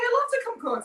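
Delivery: squealed high and loud. Retold or imitated. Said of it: "He's like".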